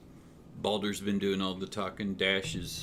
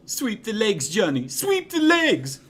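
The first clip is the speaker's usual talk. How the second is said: very high screaming cheer, louder and louder; mouse yelling; 12 semitones higher, 9 dB louder than usual. Acted. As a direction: scream the coaching chant at full pitch. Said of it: "you hear Georgie go".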